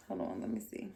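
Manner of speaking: soft whisper while checking something; whispered aside. Real, not acted. Host checks the dim lighting, whispering to herself. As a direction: mutter it under your breath in a whisper.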